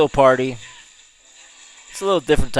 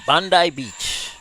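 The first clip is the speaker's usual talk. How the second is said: slow, drawn-out Australian Bondi Beach; mock Australian Bondi Beach; Aussie accent; 5 semitones higher, slower than usual. Shown as a demonstration. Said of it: "I feel so Aussie when I say that".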